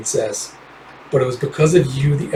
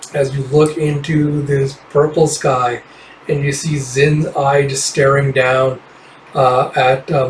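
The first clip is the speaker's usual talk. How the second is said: ordinary delivery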